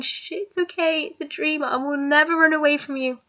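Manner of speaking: soft, hushed comforting; gently calm her down, softly; soothing hush. Retold or imitated. Husband soothing his wife after a nightmare, softly. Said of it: "he was just going".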